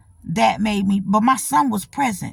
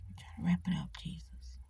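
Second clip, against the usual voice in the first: hushed, exasperated whisper to herself; whispered aside, tired of running long; 5 semitones lower, 19 dB quieter than usual. Real, not acted. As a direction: mutter it under your breath.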